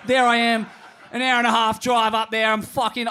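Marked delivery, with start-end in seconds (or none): none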